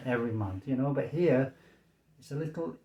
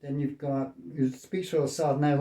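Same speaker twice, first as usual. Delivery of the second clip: usual speaking voice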